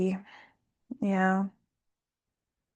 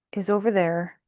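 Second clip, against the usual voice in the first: ordinary talk, nothing marked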